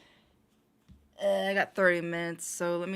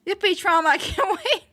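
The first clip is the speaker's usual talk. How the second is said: loud, high sarcastic yippee; mock cheer; 10 semitones higher, 6 dB louder than usual. Real, not acted. Mock celebrating a night of trauma.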